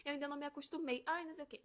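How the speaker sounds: high, sugary fake apology; a lightly voiced sweet-talking mother-in-law; mock innocent mother-in-law apologizing. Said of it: "ela sempre falava assim".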